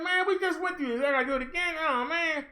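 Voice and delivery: high-pitched imitated complaint; mock whiny dismay. Cruise passenger facing quarantine twice, high mock dismay.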